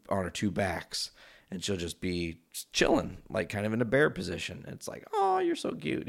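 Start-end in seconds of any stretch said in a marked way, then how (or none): 5.1-6.1 put-on very high baby-talk coo; cooing at pet. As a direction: gush over an adorable cat in falsetto. Acted cooing at the cat.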